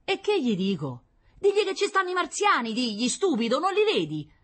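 exasperated Roman squabble; bickering couple. Acted. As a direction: argue back, annoyed. Couple's quarrel voiced.

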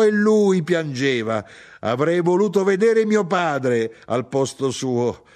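high, loud indignant tirade; an aggrieved wife ranting insistently; heated grievance. Acted. Mother rants about cowardly husband.

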